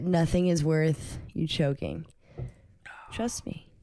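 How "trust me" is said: whispered. Real, not acted. Soothing friend with dry mouth.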